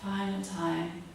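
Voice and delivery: calm breathing guidance; yoga instruction. Acted. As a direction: lead the class through each breath. Guides a breathing exercise.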